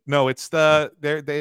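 normal talking voice

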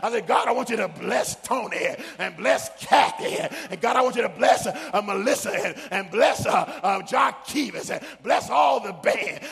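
loud, rising sermon cadence; preaching at full force. Acted. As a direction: build it up and preach to the crowd.

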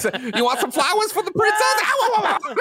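high, loud squeaky Mario, breaking into laughs; a cartoony Mario offering flowers, laughing. Playing Mario as a flower seller for laughs. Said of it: "it'll be like".

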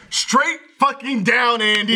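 mock exasperation. Telling teammate Andy where to jump, exasperated emphatic retort. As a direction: spell it out as if fed up. Same answer repeated in exasperation.

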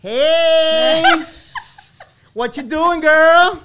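loud, high drawn-out holler; exuberant greeting. Acted. Host hailing a friend at the top, exuberant greeting. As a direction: call out a big, over-the-top hello.

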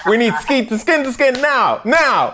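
high, insistent pretend orders; mock urgent demand. Friend acts out new dad demanding skin to skin, mock urgent. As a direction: imitate an eager dad hurrying everyone along.

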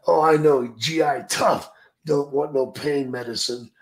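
put-on teasing Japanese accent. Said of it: "he's like".

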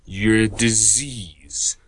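slow, drawn-out agent voice; Matrix villain impression. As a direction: pronounce it slowly like a Matrix agent. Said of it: "The Matrix".

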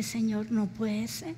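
soft, slow, despairing cry to God; tearful prayer. Retold or imitated. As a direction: pray it quietly through tears. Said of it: "Yo me puse a llorar".

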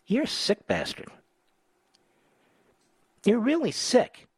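high-pitched scolding of a target; indignant, pitch pushed well up; indignant insult. Real, not acted. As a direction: fling the insult out high and disgusted. Host berates clip's comedian.

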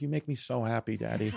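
soft, low imitation of a loving child; sweet-talking daughter. Daughter buttering up her daddy, imitated softly and sweetly. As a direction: quietly play the adoring little girl.

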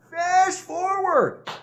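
FAST FORWARD stretched very slowly, high-pitched; drawn-out flourish. Pretend. Storyteller skips to the third quarter, slow high flourish. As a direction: draw out "Fast forward" like a mock effect.

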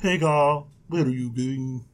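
low, dreamy angel; caterpillar-like angel, pitched low. Acted. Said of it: "Why are you talking like that?".

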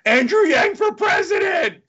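high, loud, slow campaign yell; mock scream. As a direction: shout it like a rally chant. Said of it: "wanted to scream in the middle of the grocery store".

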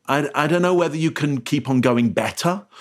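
ordinary talk

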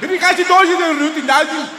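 high, loud fake German yelling; mock German shouting. Comedian mimicking the German policeman, loud mock German shout. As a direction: bark gibberish German like an angry cop.

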